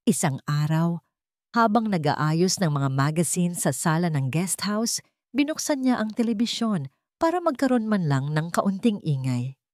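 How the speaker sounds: plain reading voice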